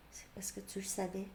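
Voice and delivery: hushed, accusing whisper; voicing the other person, whispered; whispered reproach. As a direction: breathe it like a guilty secret.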